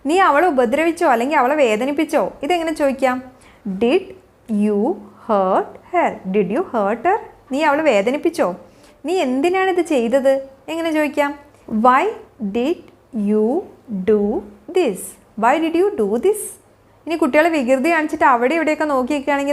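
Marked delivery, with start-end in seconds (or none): 3.7-6.4 slow and low, long gaps between words. Shown as a demonstration. Teacher modelling a question to a child for learners, word by word. 11.7-15.0 very slow, each word separated. Shown as a demonstration. Teacher showing learners how to question a child, word by word.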